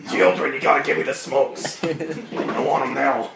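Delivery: put-on old smoker lady voice. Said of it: "the old smoker lady voice".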